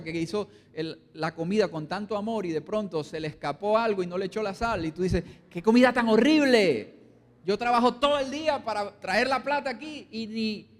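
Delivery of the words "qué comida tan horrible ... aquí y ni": louder, higher angry rant at the wife; husband berating his wife over bland food; angry complaint. Acted. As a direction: lash out at the dinner. Husband insulting wife's cooking.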